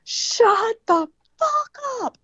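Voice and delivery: high, strained, drawn-out mock snarl; exorcist-like outburst. Drugged labouring patient to whispering relatives, possessed mock snarl. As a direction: stretch each word like someone possessed. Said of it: "turned me into the exorcist".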